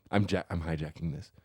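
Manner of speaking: normal talking voice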